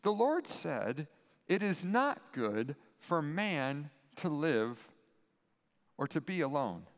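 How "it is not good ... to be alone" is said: slow solemn reading. Acted. God's words read slowly, low.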